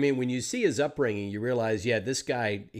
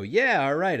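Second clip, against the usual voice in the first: louder, higher, eager yes; upbeat acceptance; 4 semitones higher, 7 dB louder than usual. Acted. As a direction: agree to the job with gusto. Acted eager reply to booking.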